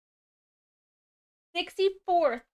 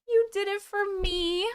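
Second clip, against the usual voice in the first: higher, softer, choked up; close to tears; 9 semitones higher, 6 dB quieter than usual. Real, not acted. Streamer to chat who did it for her, choked up on the verge of crying. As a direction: say it fighting back tears. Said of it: "not to cry on here".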